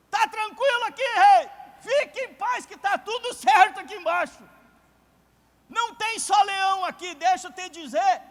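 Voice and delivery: high, shouted voice from the pit; Daniel calling up. Acted. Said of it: "uma voz sobe e diz".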